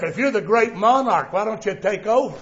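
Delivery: ordinary delivery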